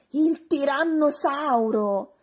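slow, loud alarmed exclamation; scared shout; frightened cry. Acted. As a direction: gasp it out in fright. Shocked discovery of tyrannosaur.

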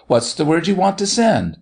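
exclaimed question rising high; cried out. A narrator's reading. Doctor asking what word to send, crying out. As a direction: ask it as an eager cry. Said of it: "cried the doctor".

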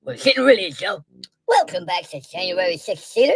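ordinary delivery

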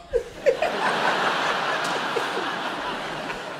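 breathless high cackle of laughter; high wheezing laugh. Real, not acted. Host cracking up at the driver's made-up saying, wheezing laugh. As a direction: laugh till the breath runs out.

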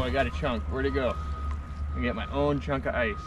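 ordinary talk, nothing marked

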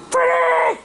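very high cry of the name; mock anguished scream. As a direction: scream the name in play horror. Friend screams as Freddy dies.

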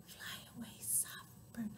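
barely audible whisper; soft. Shown as a demonstration. Sending the soft bird away, tiny whisper. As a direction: whisper it as gently as possible. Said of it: "One named Soft".

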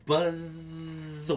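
long droning insect buzz; mosquito buzz. Acted. Storyteller showing how mosquito flew down, buzzing. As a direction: imitate a mosquito flying, drawing out the z.

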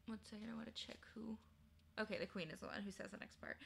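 quick whisper to herself; whispered aside. Real, not acted. The reader to herself, quick whispered aside. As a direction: mumble a quick check.

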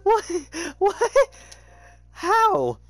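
high squeaky cries of how and what; baffled disbelief. Real, not acted. Gamer stunned by his death, high squeaky disbelief. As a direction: yelp in stunned confusion.

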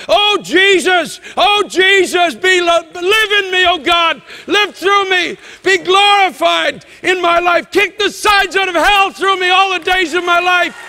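impassioned prayer cried out high and loud; heartfelt cry to Jesus. Acted. Preacher voicing the heart's cry to God, crying out. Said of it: "there's a cry comes into your heart".

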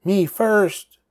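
high loud piglet yell; cried out. A narrator's reading. Pushy piglet at story time, high loud cry. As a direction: demand to go first, loudly. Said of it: "he cried at story time".

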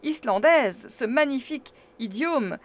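loud, scornful professor; brisk retort. A narrator's reading. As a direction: snap back with contempt. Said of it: "riposta vivement".

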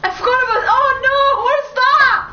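high, loud and rising alarm; real panic. Real, not acted. Gamer spotting an unknown threat, panicked cry.

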